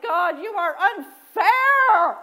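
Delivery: loud, high, drawn-out mock wail; indignant protest. Worshipper protesting to God about apologizing, loud hammed-up outrage. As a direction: complain to heaven, hammed-up and slow.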